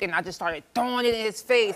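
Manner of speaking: ordinary delivery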